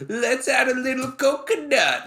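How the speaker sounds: put-on high, hammy Jamaican accent; bad Jamaican accent. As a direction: fake Jamaican lilt, way up high. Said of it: "I'm talking like a Jamaican, like a bad Jamaican accent".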